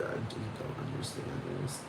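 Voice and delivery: whispered, hurried disbelief; exasperated whisper. Real, not acted. Ranter who can't understand any of it, defeated whisper. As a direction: let the voice drop away to a whisper.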